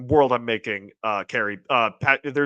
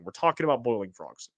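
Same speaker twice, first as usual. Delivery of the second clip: ordinary talk, nothing marked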